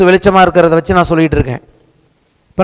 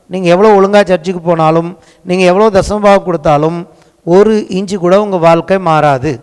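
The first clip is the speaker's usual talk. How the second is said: usual speaking voice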